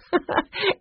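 short, high chuckle; light laugh. A narrator's reading. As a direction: let out a brief high laugh. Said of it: "Melody laughed".

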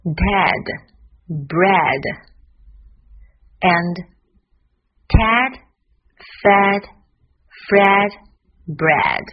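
word by word with long pauses; slow. Shown as a demonstration. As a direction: say each word on its own, very slowly. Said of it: "慢一点的". (一词一顿，慢速示范)

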